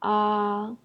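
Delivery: "Ah" held level on a high pitch; "Ah" in the Chinese first tone, flat and high. Shown as a demonstration. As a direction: sing "Ah" steady and high like a held note. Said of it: "a flat high-pitched tone".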